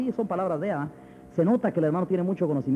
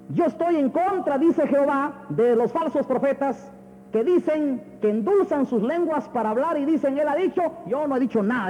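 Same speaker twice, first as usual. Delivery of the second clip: high, slow, declaimed scripture; forceful preaching; 8 semitones higher than usual. Acted. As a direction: thunder God's rebuke of the false prophets. Preaches God's rebuke from scripture.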